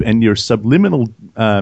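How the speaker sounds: normal talking voice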